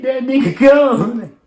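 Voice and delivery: high, loud, stretched-out mocking cackle; imitating laughing friend. Homie laughing at his passed-out friend, imitated laughing jeer. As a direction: crow it slowly as the laughing homie. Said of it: "He laughing like".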